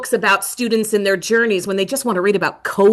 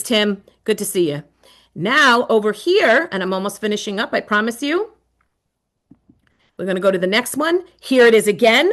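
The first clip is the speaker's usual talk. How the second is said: normal talking voice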